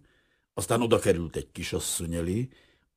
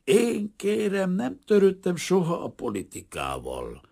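slow, higher, evasive insistence; repeating evasively; 12 semitones higher, 3 dB louder than usual. A narrator's reading. Refugee evasively repeating to curious roommates. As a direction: dodge the question politely. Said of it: "hajtogatta ilyenkor".

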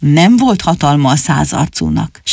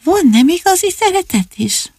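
put-on child's question; 11 semitones higher than usual. Child listener asks the storyteller.

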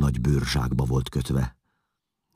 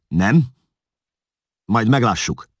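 loud, high, menacing retort; incensed challenge, voice raised; angry threat; 9 semitones higher, 8 dB louder than usual. A narrator's reading. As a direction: throw it back as a threat. Ruler threatens the cornered man.

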